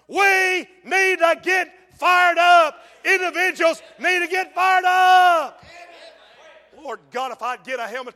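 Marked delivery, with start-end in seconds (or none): 0.1-5.6 loud, high, slow shouting sermon; fired-up preaching. Real, not acted. Preacher exhorts the church, slow loud shout. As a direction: drive it home to the pews.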